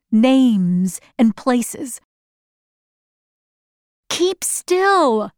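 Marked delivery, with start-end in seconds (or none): 4.2-5.3 put-on higher-pitched child's complaint; complain, pitch jumping up. A narrator's reading. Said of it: "I complained".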